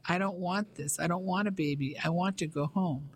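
ordinary delivery, nothing marked

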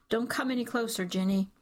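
ordinary delivery

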